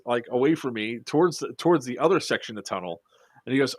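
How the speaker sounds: ordinary delivery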